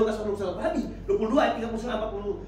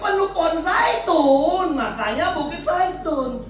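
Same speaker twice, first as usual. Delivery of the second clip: raised sermon voice with stretched key words; emphatic preaching; 7 semitones higher, 5 dB louder, slower than usual. Real, not acted. Preacher explaining the Mount of Olives' name to congregation, emphatic preaching. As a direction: proclaim the answer slowly to the congregation.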